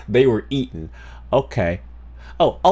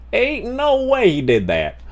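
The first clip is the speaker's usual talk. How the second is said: "Ain't no way he did that" jumps much higher, the "no" peaking; high disbelief; 10 semitones higher than usual. Real, not acted. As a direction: pitch the disbelief way up.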